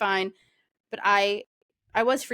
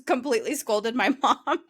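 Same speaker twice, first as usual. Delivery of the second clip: real laugh through the words; giggling as she admits it; 3 semitones higher than usual. Real, not acted. Bride laughing while telling friend she scolded mom.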